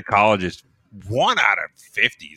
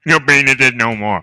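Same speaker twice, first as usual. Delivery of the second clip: strange speech pattern; 5 semitones higher, 9 dB louder than usual. Acted. Punchline in odd voice.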